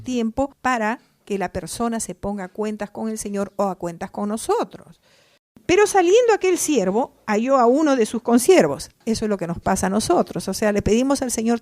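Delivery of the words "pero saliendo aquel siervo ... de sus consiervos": higher, louder preaching build; fervent scripture reading. Acted. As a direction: read the parable with rising fire. Reads parable with rising force. (lectura bíblica con fervor)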